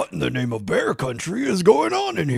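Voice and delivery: a touch of a bumbling bear dad; lightly voiced grumpy Papa Bear. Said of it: "everyone who does a Papa Bear voice".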